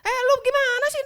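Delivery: sharp, high, loud reprimand; harsh rebuke. Shown as a demonstration. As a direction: snap at someone rudely. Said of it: "tegur orang dengan kasar". (teguran kasar dengan nada tinggi)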